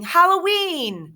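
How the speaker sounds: high, loud, slow drawn-out yell; shout. A narrator's reading. Boy shouting the holiday name to the class, excited. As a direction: stretch it out with glee. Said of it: "everyone else started shouting".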